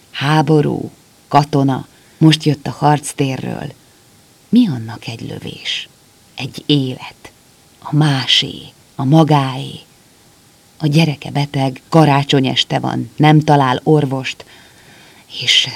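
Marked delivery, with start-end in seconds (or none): none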